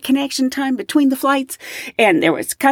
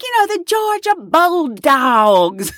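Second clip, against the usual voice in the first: high, slow, drawn-out Southern twang; strong Southern accent; 7 semitones higher, slower than usual. Retold or imitated. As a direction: stretch it in an exaggerated Southern drawl. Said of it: "a Southern flight attendant".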